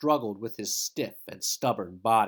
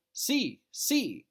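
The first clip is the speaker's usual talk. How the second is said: excited si; 10 semitones higher than usual. Acted. Hot desert, excited interjection.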